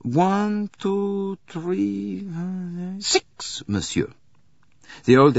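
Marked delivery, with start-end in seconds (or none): 0.0-3.6 high, hesitant counting aloud; counting on fingers. Acted. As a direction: count up haltingly, stretching each number. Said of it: "The conductor counted on his fingers".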